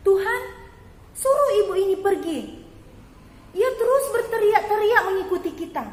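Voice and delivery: high, loud exasperated protest; annoyed complaint. Acted. Disciples complaining to Jesus about the woman, loud and exasperated. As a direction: tell him to send her away, fed up.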